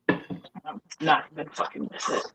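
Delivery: unvoiced whispered aside; whispering. Real, not acted. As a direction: mutter it under your breath. Muttered aside off mic.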